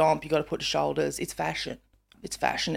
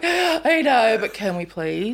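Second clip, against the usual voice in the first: playful pleading; 3 semitones higher, slower than usual. Retold or imitated. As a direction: beg in a mock-wheedling tone. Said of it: "And I'm like".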